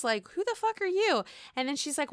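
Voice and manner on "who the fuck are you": high-pitched mock indignation; mock outrage. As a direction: toss it back high and sharp. Retelling Robin's reply.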